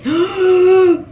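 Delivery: loud gasp with a cry; shocked gasp. Real, not acted. Shocked by the nude scene.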